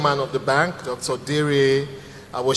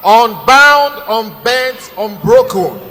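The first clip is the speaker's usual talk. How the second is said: announcer shout; 6 semitones higher, 8 dB louder, slower than usual. Acted. Hyping the sponsor's slogan.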